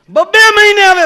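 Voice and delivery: mock shout. Repeated phrase in the rant.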